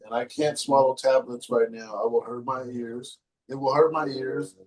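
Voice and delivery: usual speaking voice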